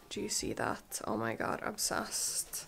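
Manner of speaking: unvoiced hushed awe; whisper. Real, not acted. Whispering to viewers over a luxury blazer. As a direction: breathe it out in reverent awe. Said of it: "I just feel like I have to whisper".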